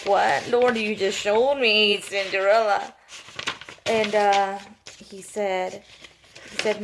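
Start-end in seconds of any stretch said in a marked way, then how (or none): 0.1-2.9 surprised protest. Retold or imitated. Retelling her surprised answer to God.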